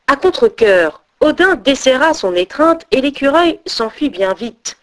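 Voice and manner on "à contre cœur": plain reading voice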